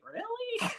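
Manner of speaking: high, soft questioning "really"; softly doubt it, pitched right up; sceptical disbelief. Retold or imitated. Vlogger recalls doubting a laptop offer, high soft disbelief.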